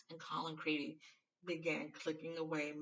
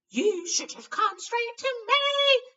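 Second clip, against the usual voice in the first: shrill, slow, furious scolding, much higher and louder; raging nurse; 15 dB louder, much slower than usual. A narrator's reading. Nurse scolding Harry for not coming, raging. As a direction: rage at the patient slowly. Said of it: "she raged".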